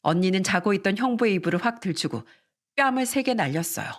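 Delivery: ordinary delivery, nothing marked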